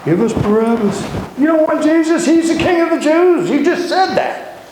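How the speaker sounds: high, fed-up ranting yell; yelling Pilate. Retold or imitated. Pilate yelling at the priests at night, high, irritated ranting. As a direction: rant like a tired ruler who just wants to sleep. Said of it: "He goes out and yells at the priests".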